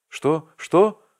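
high, loud urgent asking; shout. A narrator's reading. Arkady leans over Vasya and shouts, alarmed. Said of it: "закричал Аркадий".

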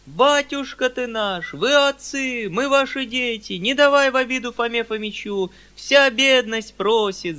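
высокая, протяжная мольба толпы; кричи нараспев, моля за всех мужиков; крик мужиков. A narrator's reading. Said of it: "закричали ещё раз мужики". (громкий просительный вопль)

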